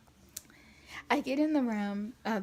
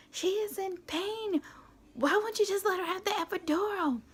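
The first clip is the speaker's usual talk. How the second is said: arguing mother; 8 semitones higher than usual. Retold or imitated. Mom scolding the husband beside the bed, high and indignant. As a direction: imitate a mom pleading her daughter's case, stretched and high. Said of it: "they're, like, arguing right beside my bed".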